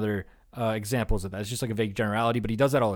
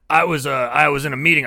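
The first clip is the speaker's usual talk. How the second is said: louder mock retelling of his boast; lightly voiced imitation, pushed louder; retold quote; 6 dB louder than usual. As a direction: imitate a man holding forth, louder. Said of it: "he goes".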